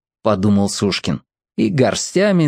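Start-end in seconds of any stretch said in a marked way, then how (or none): none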